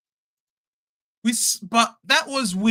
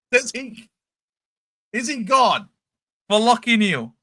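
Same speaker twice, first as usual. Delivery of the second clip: usual speaking voice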